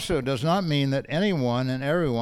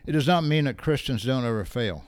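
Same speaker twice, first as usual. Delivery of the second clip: usual speaking voice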